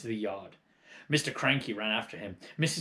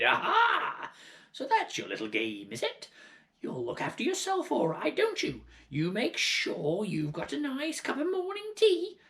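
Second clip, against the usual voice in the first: high, sneering hag voice; accusing old hag; 14 semitones higher than usual. Acted. Grandma accusing George of selfishness, high sneering hag. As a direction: jeer at the boy from above.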